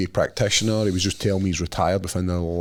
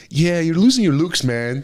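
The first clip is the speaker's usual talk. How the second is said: louder, pitch up, mixed American-Scottish teasing; American Scottish accent; 8 semitones higher, 4 dB louder than usual. Retold or imitated. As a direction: tease in Marcos's mixed accent. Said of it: "American Scottish accent".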